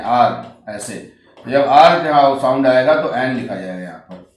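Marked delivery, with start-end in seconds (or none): 0.0-0.7 आर said like hour with a silent h; hour said as aar. Shown as a demonstration. Teacher showing students how hour is said, silent h. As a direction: say आर as the English hour, starting on the vowel. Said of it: "इसको हम लोग आर पढ़ते हैं".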